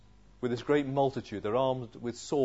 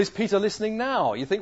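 ordinary talk, nothing marked

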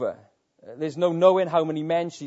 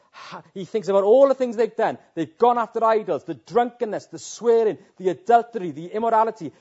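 louder and higher, hammering each sin; rising preaching intensity; 6 semitones higher, 5 dB louder than usual. Real, not acted. Preacher listing the people's sins to the congregation, loud and high. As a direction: build the list of sins like a charge.

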